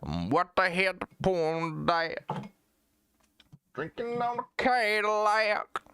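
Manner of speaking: high, twangy mock country singer; generic country singer. Streamer mocking country singers who sound alike, high drawn-out twang. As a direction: draw out a put-on country twang. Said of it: "I hear a country guy and it sounds the exact same".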